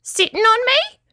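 very high tiny creature; high-pitched little claw creature; squeaky little voice. A narrator's reading. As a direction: squeak it like a small critter. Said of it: "said the voice".

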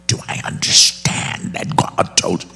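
mock-sinister, half-voiceless devil; hammed-up devil voice. Shown as a demonstration. Preacher mimicking Bible app's devil, hammed-up sinister voice. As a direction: play the audiobook devil, strained and menacing. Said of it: "say it in the voice".

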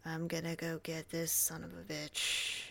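low, quiet growl; Vin Diesel impression. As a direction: growl it like an action hero. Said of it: "He's like".

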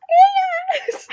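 squeaky-high, loud mock greeting; exaggerated falsetto call. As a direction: imitate a bubbly hello way up high. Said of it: "why your voice was so high".

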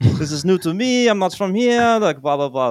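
mock French accent, high and loud, tailing off; fake French accent. Friend dodging a beach dog ticket, fake French accent. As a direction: plead innocence in a put-on French accent. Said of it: "used a French accent".

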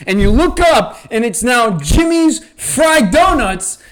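ordinary delivery

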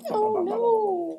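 high, drawn-out mock-horror wail; mock dismay. Hammed-up dismay at the cliffhanger.

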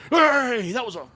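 high, loud mock sideline yell; outraged sports parent. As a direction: holler like an overinvested parent at a game. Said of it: "parents acting like it's the N F L playoffs".

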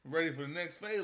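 normal talking voice

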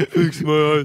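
loud attempt at a brute voice; loud mock tough-guy voice; Drax. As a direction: push it out forcefully, half-joking. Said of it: "in the voice of Drax".

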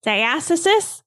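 DIASTASIS as die-ASS-uh-sis, rising as a question; unsure pronunciation. Real, not acted. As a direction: try "Diastasis" as dye-ASS-uh-sis, checking it's right. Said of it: "Am I saying that right?".

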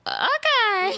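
high-pitched drawn-out okay; mock dubious okay. Date answering her denial, retold with high-pitched doubt.

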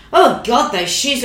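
gushing over shoes. Retold or imitated. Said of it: "I'd say things like".

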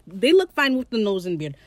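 ordinary talk, nothing marked

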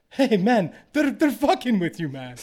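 friendly Icelandic stranger tipping him off, in a put-on Icelandic accent. Icelandic local warning him he's being pranked at Oktoberfest, Icelandic accent. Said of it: "I love that Icelandic accent".

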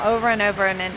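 ordinary talk

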